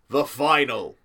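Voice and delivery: normal talking voice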